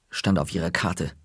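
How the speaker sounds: plain reading voice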